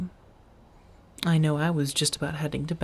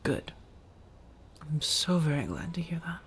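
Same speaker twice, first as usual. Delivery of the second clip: soft relieved whisper; 6 dB quieter than usual. Acted. Relieved listener is well.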